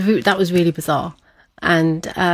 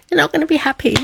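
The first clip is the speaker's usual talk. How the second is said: teasing sing-song warning, much higher; mocking sisters; 10 semitones higher, much faster than usual. Sisters warning her the parents won't be pleased, mock high. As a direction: pitch way up like a taunting sibling.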